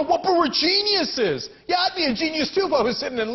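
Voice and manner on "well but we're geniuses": high, slow, hammed-up smug banker; lightly mock the bankers' self-praise; sneering imitation of bankers. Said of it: "And then the bankers go".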